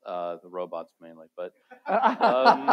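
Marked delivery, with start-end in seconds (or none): 1.4-2.7 loud high burst of laughter; high laugh at his own joke; real high laugh. Real, not acted.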